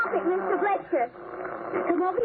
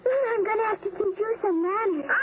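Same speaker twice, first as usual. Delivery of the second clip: ordinary talk, nothing marked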